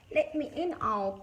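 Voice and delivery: loud, high-pitched call. A narrator's reading. Mouse calls to Owl from the freezing storm, calling out. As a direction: call to be let in, pitched well up. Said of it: "cra-, called Mouse".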